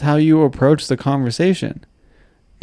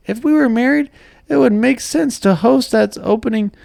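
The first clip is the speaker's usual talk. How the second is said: cartoon character voice; 11 semitones higher than usual. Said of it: "like a cartoon character".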